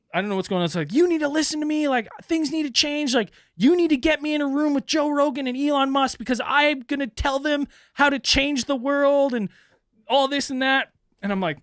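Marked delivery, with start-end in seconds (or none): 0.9-10.9 higher, louder imitation of the caller; mock caller voice. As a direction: pitch it up as the grandiose stranger. Voicing the caller again.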